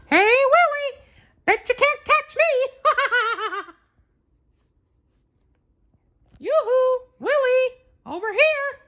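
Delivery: very high, loud falsetto taunting; high cartoon mouse teasing the giant; Mickey teasing. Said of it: "He teased the giant".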